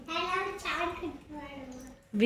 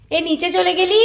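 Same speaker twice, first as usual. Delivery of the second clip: raised, louder call; 6 semitones higher, 7 dB louder than usual. Real, not acted. Mother calls after daughter who went downstairs, calling out. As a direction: call after someone, pitch up.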